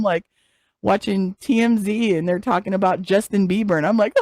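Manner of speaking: ordinary talk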